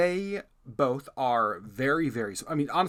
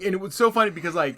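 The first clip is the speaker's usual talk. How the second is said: ordinary talk, nothing marked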